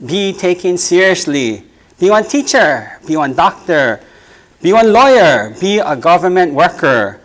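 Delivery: rhythmic listing, slowed on key lines; poem recitation. Acted. Poet performs the pidgin poem for the audience, rhythmic reading. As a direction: recite it as a building litany.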